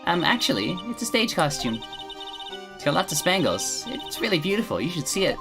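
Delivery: put-on high, sweet girlish voice; bright innocent animal tamer girl; cheerful young girl voice.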